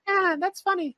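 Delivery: put-on very high giggly child; cheeky kid voice. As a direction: chirp it like a cheeky little kid.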